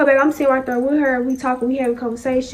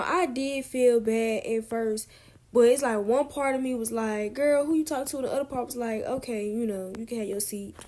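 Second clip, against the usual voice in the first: much softer, inward voice; quiet; 11 dB quieter than usual. Real, not acted. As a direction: drop to a near hush.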